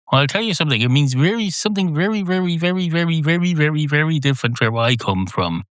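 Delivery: put-out cat talking high and emphatic; emphatic contradiction. Acted. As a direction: insist with rising, rolling repetition. Cat emphatically disputes the meaning.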